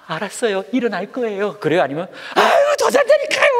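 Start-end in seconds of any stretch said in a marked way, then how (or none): 0.1-1.6 put-on compliant child. Child answers parent waking them. 2.3-3.5 very high, loud whiny yell; hammed-up child's tantrum shriek; angry at parents. Sleepy child to parents waking them, acted angry yell. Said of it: "부모님께 막 이렇게 막 화내죠".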